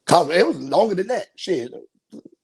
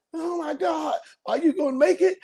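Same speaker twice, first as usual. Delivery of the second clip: scared passenger; 9 semitones higher than usual. Retold or imitated. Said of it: "holding on for her dear life".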